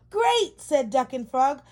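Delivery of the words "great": high, loud cheer; excited. Acted. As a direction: cheer with delight. Duck and Frog cheer.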